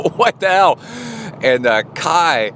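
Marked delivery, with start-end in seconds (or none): 0.0-0.8 high, loud retold yelp of alarm; startled yelp. Father sitting up in bed beside his wife, startled yelp. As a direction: jolt upright with a high-pitched exclamation.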